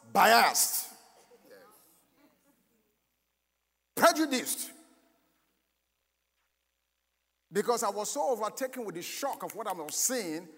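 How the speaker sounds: loud, ringing preacher voice; preaching. Acted. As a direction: preach it to the back row. Preaching at full force.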